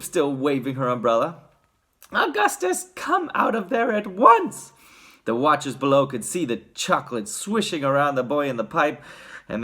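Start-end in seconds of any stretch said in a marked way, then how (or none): 2.1-4.7 high-pitched scolding mother; shrieky maternal command; Missus Gloop. A narrator's reading. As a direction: order your son out in a high voice. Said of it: "yelled Missus Gloop".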